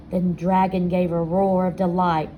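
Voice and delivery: ordinary delivery, nothing marked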